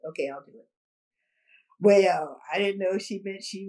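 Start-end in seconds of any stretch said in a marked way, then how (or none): 0.1-0.7 soft, understated agreement; calm quiet okay. Retold or imitated. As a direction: drop it quietly, no fuss. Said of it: "And that's how she said it".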